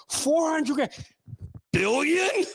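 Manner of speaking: high incredulous squeak; mock shock, voice up high; mock disbelief. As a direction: act stunned at the small sum. Mock disbelief at tiny bribe.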